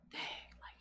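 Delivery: breathy whispered awe; barely voiced, moved to tears. Recalling crying over her son, whispering. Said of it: "I cried one day".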